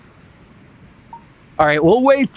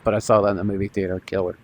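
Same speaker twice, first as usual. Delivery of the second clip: mumbled aside; 13 semitones lower, 7 dB quieter, much faster than usual. Real, not acted. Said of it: "I'm probably dating myself".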